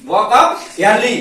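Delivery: very slow, spaced out; slow, deliberate repeat. Shown as a demonstration. Teacher repeating the English phrase very slowly for students. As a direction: drag out each word for the class to copy. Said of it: "क्या संदेश दे रहा मुर्गा".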